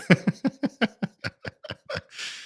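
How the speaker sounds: airy high-pitched laugh; high giggle. Real, not acted. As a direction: laugh at your own bad voice. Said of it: "my nerd voice isn't that good".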